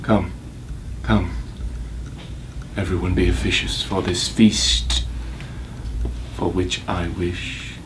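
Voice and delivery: low, soft and slowing; quiet grim menace. Acted. Avenger summons all to his feast, quiet menace. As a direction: sink into a hushed, deliberate close.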